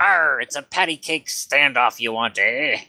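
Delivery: rally the crew like a pirate. Acted. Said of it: "The pirate goes".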